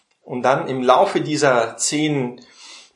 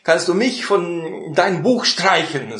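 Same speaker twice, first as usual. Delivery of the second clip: high, urgent pleading protest; Moses protests; 5 semitones higher than usual. Retold or imitated. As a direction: Moses throws himself between God and the people. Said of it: "Und dann hat Mose gesagt". (Mose widerspricht Gott hoch und eindringlich)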